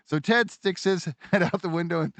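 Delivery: ordinary delivery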